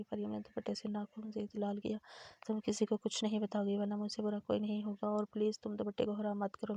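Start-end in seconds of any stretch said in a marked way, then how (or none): none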